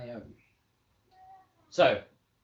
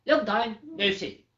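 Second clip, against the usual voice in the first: hare greeting; 7 semitones higher, 5 dB louder than usual. Acted. Hare voiced in story.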